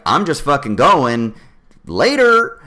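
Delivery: carefree Texan driver. Retold or imitated. Daughter voicing a Texas trucker, loud carefree send-off. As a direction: toss it off like a carefree trucker speeding away. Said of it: "the Texan one is like".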